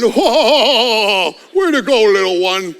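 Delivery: lightly voiced old man praising; a hint of a doting grandpa. Pastor imitating an old man praising a child, lightly voiced. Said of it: "an eighty-year-old man".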